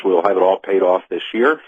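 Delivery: usual speaking voice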